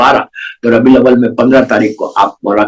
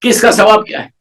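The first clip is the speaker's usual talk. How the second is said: raised, higher fervent sermon appeal; impassioned preaching; 10 semitones higher than usual. Acted. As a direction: plead with the listeners, rising in fervor. Preaching at full force. (پرجوش خطابت، بلند آواز میں appeal)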